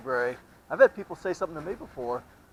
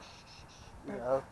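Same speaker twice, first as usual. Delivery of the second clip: sharp unvoiced "shh, shh"; shushing; 3 semitones lower, 15 dB quieter than usual. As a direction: hush them like a librarian. Imitating shushing in a library.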